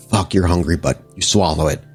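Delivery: usual speaking voice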